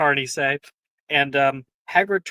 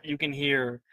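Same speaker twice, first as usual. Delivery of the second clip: ordinary talk, nothing marked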